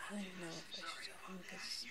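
hushed worried whisper. Real, not acted. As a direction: whisper it like a secret. Confiding worry, unvoiced whisper.

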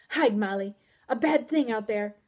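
loud, frightened plea; urgent scared warning. A narrator's reading. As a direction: warn her, afraid. Guest warns in fear.

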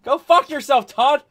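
loud, high-pitched outburst at a teasing friend; exasperated comeback. Real, not acted. Streamer telling off the friend who needles him, exasperated. Teased by friend, snaps back in banter.

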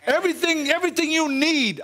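high, emphatic preaching; rising fervor. Real, not acted. Preacher exhorting congregation, high and fervent. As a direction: build to a peak on it.

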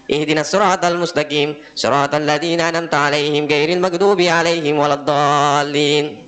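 run-on recitation. Shown as a demonstration. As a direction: rush through without the pauses. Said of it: "Tapi beda dengan cara". (bacaan cepat bersambung)

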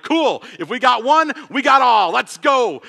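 eager yes. Retold or imitated. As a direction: jump at the idea with gusto. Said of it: "And I'm like".

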